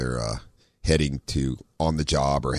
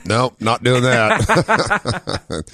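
laughing refusal; 8 semitones higher, 4 dB louder, much slower than usual. Real, not acted. Laughing off trading voices.

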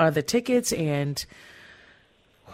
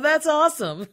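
said through a real high laugh; delighted, laughing as she speaks; 10 semitones higher than usual. Real, not acted. Host laughing at album name.

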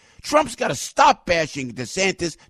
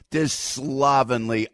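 ordinary talk, nothing marked

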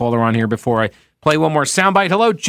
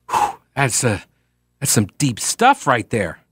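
ordinary talk, nothing marked

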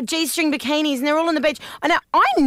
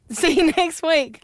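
stifled giggles; slower than usual. Real, not acted. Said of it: "I'm trying not to laugh".

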